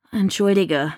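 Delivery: ordinary delivery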